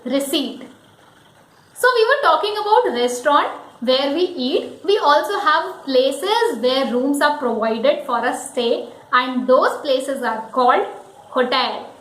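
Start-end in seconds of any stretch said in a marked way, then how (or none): 0.1-0.6 "receipt" as rih-SEET; silent P. Shown as a demonstration. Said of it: "where P is silent". 11.3-11.9 "hotel" as ho-TEL; stress on TEL. Shown as a demonstration. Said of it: "the focus is on tail".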